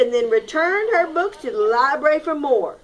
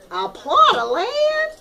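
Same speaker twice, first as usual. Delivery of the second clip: surprised; 11 semitones higher than usual. Acted. Hen misunderstands the rule.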